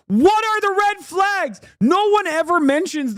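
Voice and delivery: high shout, stress on What; exasperated shout. Real, not acted. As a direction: yell it in frustration. Exasperated outburst.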